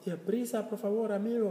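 high, eager hurry-up to a friend; urging a friend. Retold or imitated. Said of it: "you can tell".

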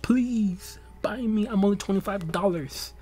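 high-pitched coaxing voice; pleading gift card. Acted. Robux card begging to be bought, high wheedling plea. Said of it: "like, saying".

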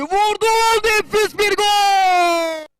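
long, drawn-out goal roar, high and loud; screaming football commentator. Real, not acted. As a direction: stretch out the goal call at full voice. Replay of the goal call.

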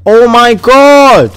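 a long groan of disbelief; drawn-out oh my god. Real, not acted. Gamer seeing the storm arrive, slow drawn-out dismay. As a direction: stretch it out slowly in dismay. Storm closing in during match.

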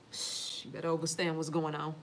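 shh, then a low, soft warning; hushed warning. Real, not acted. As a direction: drop to a hush and warn them. Hushing the viewers.

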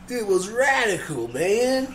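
slow, high surfer drawl; surfer dude voice. Pretend. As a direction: stretch it out like a nineties surfer. Surfer slang riff.

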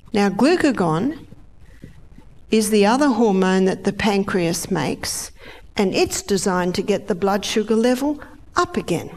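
ordinary delivery

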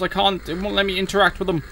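usual speaking voice

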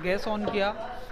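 usual speaking voice

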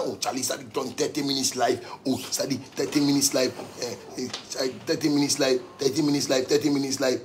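a hushed, half-hearted imitation of begging; mock pleading.

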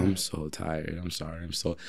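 exhausted mumble. Retold or imitated. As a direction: mumble it like you can barely stay awake. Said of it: "I'm like".

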